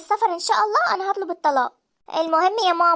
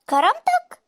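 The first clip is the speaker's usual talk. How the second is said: much higher, sharp disbelieving echo; incredulous scoff; 10 semitones higher, slower than usual. Acted. Mother to her son about his pride, incredulous. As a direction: throw his word back at him in disbelief.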